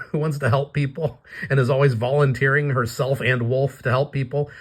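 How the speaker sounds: ordinary delivery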